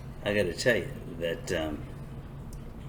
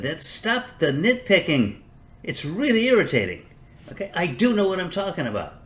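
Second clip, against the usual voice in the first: mock irritation; 9 semitones higher, 4 dB louder than usual. Snapping at imagined nitpickers.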